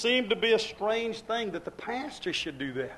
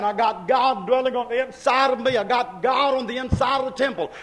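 ordinary talk

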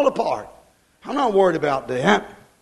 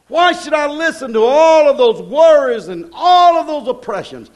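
full-force preaching; 7 semitones higher, 5 dB louder than usual. Acted. Preacher's rhetorical questions.

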